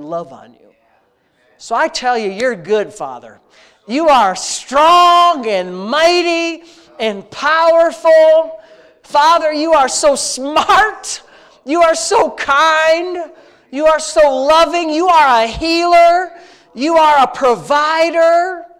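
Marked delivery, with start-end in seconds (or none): 1.6-3.4 earnest, intimate prayer voice, addressing God like a father; heartfelt spoken prayer. Real, not acted. Pastor leading his congregation in prayer, earnest and intimate. 3.9-18.7 slow, loud and higher, each attribute of God declared; loud proclaiming praise. Real, not acted. Pastor crying out God's greatness before the congregation, loud and slow.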